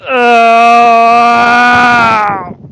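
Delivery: long loud groan while stretching. Real, not acted. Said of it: "I had to stretch".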